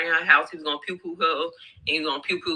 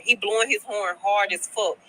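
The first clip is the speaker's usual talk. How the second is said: usual speaking voice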